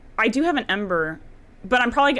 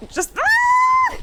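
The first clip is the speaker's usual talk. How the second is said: high, loud panicked scream; 4 dB louder than usual. Real, not acted. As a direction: shriek in sudden panic. Panicked in boss fight.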